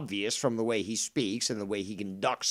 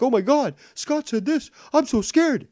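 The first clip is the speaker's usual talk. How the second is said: much higher, hammed-up scared voice; mock fright; 11 semitones higher, 5 dB louder than usual. Ranting streamer mocking fans scared of Scott, high fake fright. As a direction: whimper it like a fake-scared dupe.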